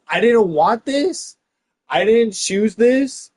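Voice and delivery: high, loud, slow mock epic; mock epic lament. Comic parodying a reluctant epic hero, slow and loud. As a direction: play the reluctant hero grandly.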